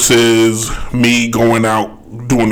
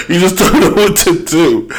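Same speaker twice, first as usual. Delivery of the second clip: laughing; 8 semitones higher than usual. Real, not acted. Laughing at his own skit.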